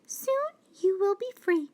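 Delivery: high, quick mouse question; mouse asking. Acted. Mouse arriving to answer the lion's call, high and quick. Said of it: "she said".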